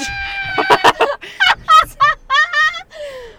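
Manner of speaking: high shrieking laugh; loud laugh. Real, not acted. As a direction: burst out laughing. Laughs at her own joke.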